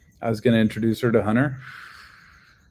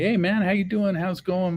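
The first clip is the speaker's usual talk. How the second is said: bright high hello, much higher; cheery greeting; 11 semitones higher than usual. Retold or imitated. Answering the call thinking it's Hunter, high cheery greeting. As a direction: greet a friend warmly on the phone.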